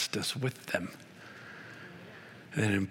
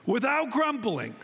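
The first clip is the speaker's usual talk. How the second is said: preaching Paul's command; 11 semitones higher than usual. Acted. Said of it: "Paul says".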